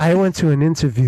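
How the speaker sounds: loud, low, level delivery; loud into the mic. Shown as a demonstration. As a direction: boom it right into the microphone. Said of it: "And I sounded like this".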